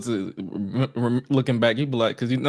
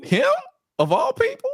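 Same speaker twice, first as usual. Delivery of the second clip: very high, squeaky disbelief; baffled, breaking it into astonished little questions; mock incredulity. Acting out reaction to own tweet.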